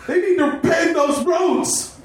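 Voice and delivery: high, drawn-out shouted character voice; mock yell. Helen yells to Heather after tripping, hammed-up high shout. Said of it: "and yells".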